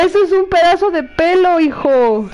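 high exasperated explanation; scolding her son. Real, not acted. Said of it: "que ando peleando con mi hijo".

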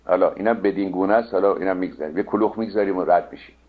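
normal talking voice

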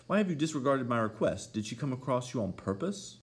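high, insistent questioning; demanding reproach. A narrator's reading. Mistress confronting disobedient man.

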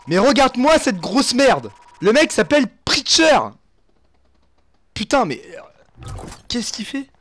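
strained, cracked yelling voice; hoarse from yelling. Real, not acted. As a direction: voice worn raw from shouting. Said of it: "j'ai la voix éclatée".

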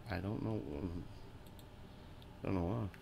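usual speaking voice

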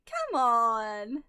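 loud, drawn-out exasperated plea. Real, not acted. Player begging the pack for luck, exasperated plea. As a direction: plead loudly, stretching it out.

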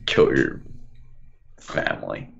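low, dark hammed-up threat; mock sinister. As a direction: drop into a sinister voice. Mock menacing line.

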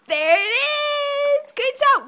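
high, slow sing-song praise. Real, not acted. Praising baby.

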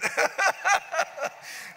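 high breathy burst of laughter; big laugh. Real, not acted. Preacher laughing at his own nickname story, a high breathy burst. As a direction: laugh at the punchline.

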